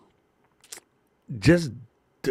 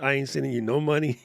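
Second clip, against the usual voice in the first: ordinary talk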